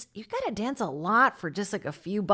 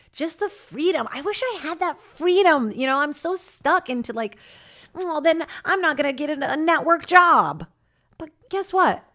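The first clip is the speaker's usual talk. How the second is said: wistful excitement; 9 semitones higher, 5 dB louder than usual. Real, not acted. Envying stripper freedom.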